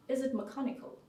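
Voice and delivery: put-on detached engineer's query in a German accent; clinical German. German boyfriend asking about her pain, clinical German accent. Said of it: "in a really German way".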